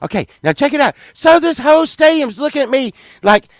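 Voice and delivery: normal talking voice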